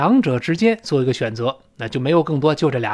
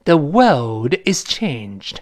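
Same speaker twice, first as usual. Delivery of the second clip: very slow British quote, r-less "world"; thick British accent; much slower than usual. Shown as a demonstration. Teacher requoting the film for learners, slow British accent. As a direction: draw the line out in British English. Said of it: "就没有卷舌了".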